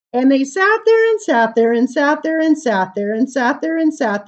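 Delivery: bright, lilting read-aloud voice; reading to children. Acted. Reading a funny giraffe poem to children, sing-song. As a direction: read the rhyme playfully to the kids.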